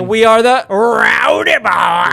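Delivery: loud, slow, drawn-out sign-off shout; hype shout. Acted. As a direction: yell the show name like an arena announcer.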